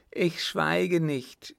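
high, slow, stubborn refusal; defiant cry. Acted. Son refusing to be silenced, crying out. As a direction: cry it out, drawn out. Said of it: "rief der Sohn".

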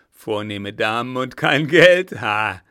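loud, mocking shout with a scornful ha; rough robber's shout. Robber jeers at the ladies in the carriage, loud and rough. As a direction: jeer at the noble ladies like a rough highwayman. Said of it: "rief eine raue Stimme".

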